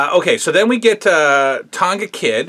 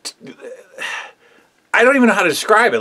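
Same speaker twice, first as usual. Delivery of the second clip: ordinary talk, nothing marked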